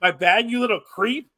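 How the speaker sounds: high, loud outraged wrestler impression; Kevin Owens. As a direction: accuse him in a hammed-up, high-pitched shout. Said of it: "the real Kevin Owens comes out".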